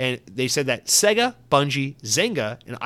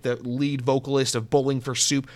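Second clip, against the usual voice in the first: ordinary delivery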